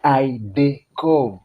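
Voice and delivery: "I dey come" said slowly, come as "kom"; Pidgin pronunciation. Shown as a demonstration. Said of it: "my pronunciation".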